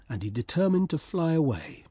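plain reading voice

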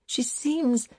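high, straining voice about to break; a touch of tearful strain. Acted. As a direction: let the voice crack upward. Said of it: "tried to talk through her sobs".

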